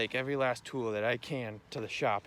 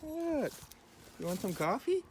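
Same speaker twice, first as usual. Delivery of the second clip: high dog-talk voice; 6 dB louder than usual. Real, not acted. Farmer greeting his dogs in a high, loud doggy voice.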